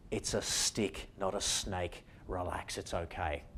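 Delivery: soft soothing reassurance; calm a frightened mind quietly; reassuring. Retold or imitated. Voicing the calming nerve message.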